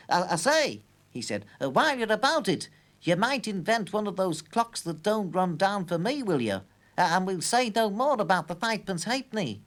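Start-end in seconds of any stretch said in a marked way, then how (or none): 0.1-0.8 raised, eager shopkeeper; clockman voice. A narrator's reading. Clockman calls after professor. 1.6-9.6 higher, louder shopkeeper voice; sly tradesman angling a favour; clockman voice. A narrator's reading.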